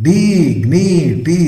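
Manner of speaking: Dignity very slow and high, in three beats; syllable by syllable. Shown as a demonstration. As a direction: stretch each syllable of Dignity. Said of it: "three syllables".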